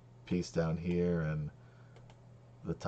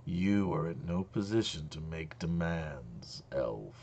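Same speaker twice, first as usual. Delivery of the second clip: slow, measured dragon voice; imperious dragon; slower than usual. Acted. Dragon rebuffs the elf's demand.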